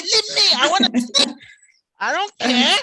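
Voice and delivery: high, loud whiny refusal; laughing it off, loud and high; mock lazy refusal. Brushing off a pushy colleague: high laughing whine. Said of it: "I was like".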